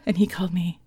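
usual speaking voice

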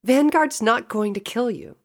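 high, loud, annoyed reassurance; trying to sound annoyed. A narrator's reading. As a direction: brush the worry off, high and loud. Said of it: "She tried to sound annoyed".

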